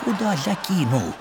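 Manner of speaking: puzzled words spoken through a laugh; half-laughing at a puzzle; laughing quote. Retold or imitated. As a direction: chuckle while wondering aloud. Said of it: "думаю".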